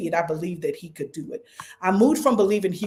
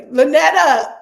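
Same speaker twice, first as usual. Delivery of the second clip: calling out name; 7 semitones higher, 10 dB louder, slower than usual. Real, not acted. Calling out to Lynetta.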